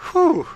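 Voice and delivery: loud, high sigh of relief; relieved whew. Real, not acted. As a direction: blow out a big relieved whew. Relief after escaping monster.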